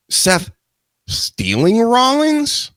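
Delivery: high slow disbelieving question; incredulous. Real, not acted. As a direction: stretch it out in disbelief.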